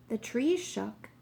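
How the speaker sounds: usual speaking voice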